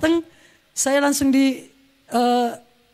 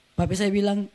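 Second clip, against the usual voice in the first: ordinary delivery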